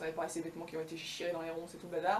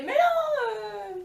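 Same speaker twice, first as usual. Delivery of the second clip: tortoise protest; 8 dB louder, much slower than usual. Acted.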